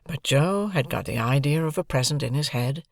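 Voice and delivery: plain reading voice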